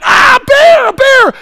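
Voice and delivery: high, loud, thrilled yelling; excited scream. Retold or imitated. Preacher reliving the campsite dawn to the boys: thrilled shout. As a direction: cry it out like you spotted something amazing. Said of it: "I started to scream".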